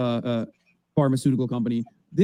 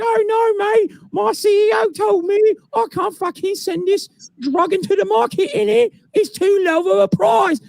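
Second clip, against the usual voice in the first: put-on shouting London accent; slower than usual. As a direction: belt it out in a brash London accent. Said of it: "they're speaking in English in the U K".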